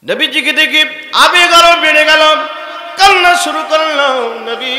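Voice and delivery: high, loud, chanted sermon cry; impassioned preaching. A narrator's reading. Preacher tells the crowd of a convert crying to his Lord, high loud chant. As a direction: preach it out loud and high, drawing out phrase ends. Full-force sermon narration.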